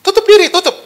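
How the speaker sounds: very high, put-on devil voice; devil's urging. Preacher mimics the devil urging people to cover up, high put-on voice. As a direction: urge it in a high devilish pitch.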